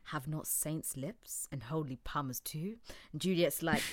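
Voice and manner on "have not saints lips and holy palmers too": breathy Romeo whisper. Pretend. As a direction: whisper the line like a seducer.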